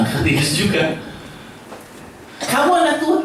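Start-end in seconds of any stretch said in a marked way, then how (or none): 2.4-3.2 teasing question. Acted. Someone asks if child of God.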